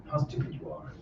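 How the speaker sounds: hushed, breathy whisper of the repeat. Shown as a demonstration. Monk whispers the jab again to his listeners. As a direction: drop to a whisper to let it sink in.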